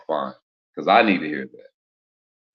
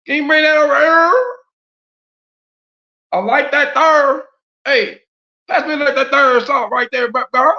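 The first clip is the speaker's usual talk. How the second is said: country folk; 13 semitones higher, 6 dB louder than usual. Retold or imitated. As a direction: stretch it out like a country cousin. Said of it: "Country folk be like".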